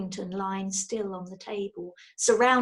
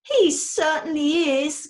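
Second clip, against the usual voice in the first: higher, louder, slow gush; 6 semitones higher, 7 dB louder than usual. A narrator's reading. Wife gushing about husband, slow and effusive. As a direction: pour out the admiration. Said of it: "gushed Missus Brown".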